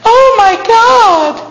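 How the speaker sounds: put-on high, drawn-out valley-girl gasp; squealing teenage girl "Oh my God"; mock teen girl. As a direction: mimic a careless teenage girl.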